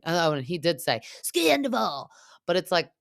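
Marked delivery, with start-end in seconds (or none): 1.2-2.1 high-pitched mock cry; Sandoval. Sandoval at the demo wall, imitated high cry. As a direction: imitate a venting yell without full volume.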